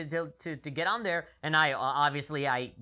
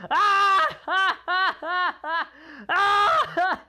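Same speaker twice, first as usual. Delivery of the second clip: mock laughter; 14 semitones higher, 10 dB louder, much slower than usual. Everybody laughing at him.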